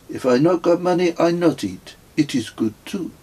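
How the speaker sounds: a content French pilgrim explaining begging; pilgrim explaining his philosophy, French accent. A narrator's reading. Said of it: "in a strong French accent".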